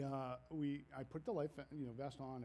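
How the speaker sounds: ordinary talk, nothing marked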